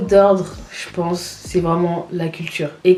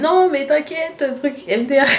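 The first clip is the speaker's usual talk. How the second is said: laughing reassurance; 8 semitones higher than usual. Retold or imitated.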